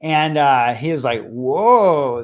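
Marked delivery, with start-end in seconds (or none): 1.3-2.2 loud, long swooping whoa; awestruck whoa. Retold or imitated. Boy offered a limo to Uchi, awestruck. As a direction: mimic a kid blown away.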